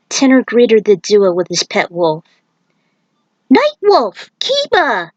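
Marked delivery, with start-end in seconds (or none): 3.5-5.1 high, excited calling of names; bursting with joy at the reunion; thrilled. Acted. Aiko greeting Nightwolf and his wolf, thrilled. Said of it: "She was thrilled to see them".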